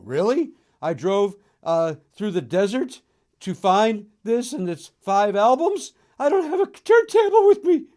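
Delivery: growing indignation, ending in a high, loud squeal; exasperated treasure hunter. Retold or imitated. Imagined digger upset at finding records, rising to an indignant squeal. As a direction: let the outrage build to a high yelp. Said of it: "And then you go".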